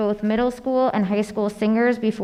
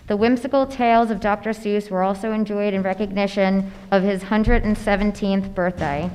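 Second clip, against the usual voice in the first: ordinary talk, nothing marked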